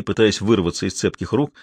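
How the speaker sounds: plain reading voice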